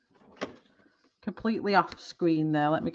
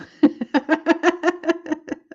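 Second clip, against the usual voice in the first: high laugh; 11 semitones higher, 5 dB louder than usual. Real, not acted. Wife laughing at husband crying, loud high laugh.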